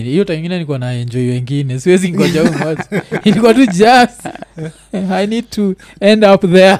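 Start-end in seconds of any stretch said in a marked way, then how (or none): 1.8-6.8 laughing while talking. Real, not acted. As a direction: laugh through the words. Laughing about waiting.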